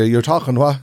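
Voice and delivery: normal talking voice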